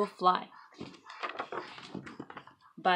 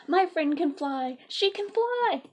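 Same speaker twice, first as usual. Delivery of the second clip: higher, bright pride; proud delight; 9 semitones higher, 6 dB louder, slower than usual. Acted. Elephant proud of Piggy, higher pitched. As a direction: boast about your friend.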